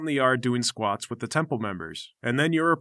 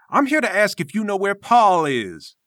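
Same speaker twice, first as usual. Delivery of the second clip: lightly voiced lofty master; a hint of preachy self-importance; pompous temple master; 5 semitones higher, 4 dB louder than usual. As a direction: defend your noble discipline with affronted dignity. Game master voices Namir.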